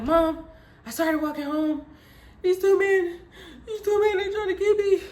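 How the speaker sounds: high, breathless mock crying; hammed-up high whimpering; tearful telling. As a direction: blurt it to mom between gasps. Retold tearful report to mom.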